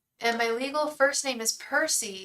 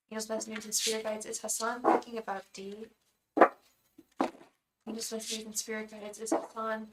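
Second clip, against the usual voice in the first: quiet invocation; 4 dB quieter than usual. Acted. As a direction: call on the angels, softer each time. Repeats a quiet invocation to spirit guides.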